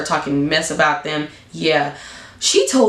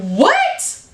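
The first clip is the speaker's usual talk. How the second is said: much higher, stunned what; shocked what; 12 semitones higher than usual. Retold or imitated. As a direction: gasp it out in disbelief. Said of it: "And I'm like".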